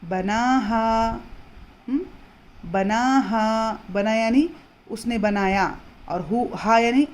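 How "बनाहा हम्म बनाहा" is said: बनाहा slowly with the long आ held; stretched vowels. Shown as a demonstration. Teacher reciting बनाहा slowly for students. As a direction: draw out the आ in बनाहा. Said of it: "दो हरकत जितना खींच कर पढ़ना है".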